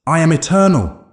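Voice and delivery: high, loud screamed boast. A narrator's reading. Dark figure thrashing against the light, high loud scream. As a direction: proclaim it at full, high-pitched volume. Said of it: "the figure screamed".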